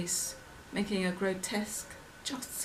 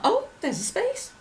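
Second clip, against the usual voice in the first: high, sudden exclamation; pleasantly surprised; 13 semitones higher, 4 dB louder than usual. Acted. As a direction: break off brightly as you spot something. Breaks off singing on spotting space.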